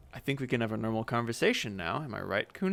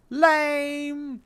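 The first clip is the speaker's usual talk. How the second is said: put-on high scoffing child; a flat kid put-down; whiny second kid; 7 dB louder than usual. Friend mocks the name, high and scoffing.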